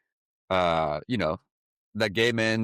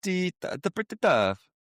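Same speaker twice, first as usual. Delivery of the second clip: higher, hammed-up Italian accent; 10 semitones higher than usual. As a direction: lean into a playful Italian accent. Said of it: "I don't know if I should be doing the Italian accent".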